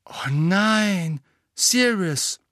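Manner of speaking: viel höher und lauter, entsetzt aufrufend; erschrocken. A narrator's reading. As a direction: ein entsetzter Ausruf beim Lesen. Erschreckende Zeitungsnachricht über Sirius. (Hermine erschrickt über die Zeitung, entsetzter Ausruf)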